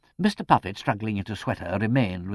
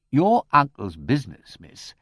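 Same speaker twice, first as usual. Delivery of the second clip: blunt; 3 semitones higher, 6 dB louder than usual. A narrator's reading. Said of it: "said Mister McBride bluntly".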